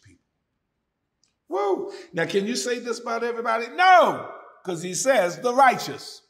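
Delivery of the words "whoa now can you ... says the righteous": high, loud exclaiming, fast in the middle; preacher's whoop. Acted. Preacher stirring up the congregation, whooping loud and high. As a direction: shout it up to the rafters.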